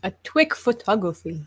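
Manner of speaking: imitating the film's German accent; lightly put-on German accent. Quoting the film's German pilot, mock German accent. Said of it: "I like how the German said".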